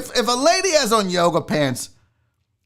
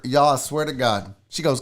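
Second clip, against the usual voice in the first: ordinary talk, nothing marked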